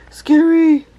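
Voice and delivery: scary shrieked loud and high. Real, not acted.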